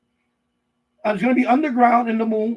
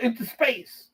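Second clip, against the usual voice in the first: ordinary talk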